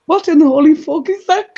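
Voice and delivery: acted-out stunned exclamation, high and loud; shocked outburst. Bestie spotting the couple in the window, shocked outburst. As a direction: blurt it out like a horrified friend.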